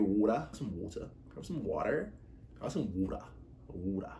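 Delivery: soft put-on Australian "water"; Australian accent. Showing how Australian friends ask for water, Australian accent. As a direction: attempt an Australian "water", quietly. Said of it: "Australia, they get".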